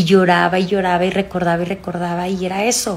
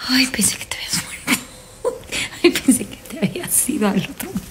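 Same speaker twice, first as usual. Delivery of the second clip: laughing relief; 6 semitones higher, 3 dB quieter, faster than usual. Real, not acted. Relieved the dog responds, laughing.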